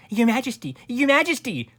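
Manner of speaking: high, loud urgent call; crying out. A narrator's reading. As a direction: call out to a king far above you. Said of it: "cried out Sir Alaric".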